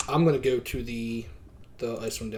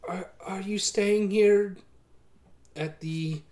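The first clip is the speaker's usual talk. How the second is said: innkeeper voice; 6 semitones higher, 5 dB louder, slower than usual. Acted. GM voicing innkeeper first take.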